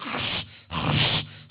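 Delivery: loud harsh Velcro ripping noise; rasping, voiceless rip sound; noisy Velcro imitation. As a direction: mimic Velcro straps tearing open. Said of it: "just making all the noise in the world".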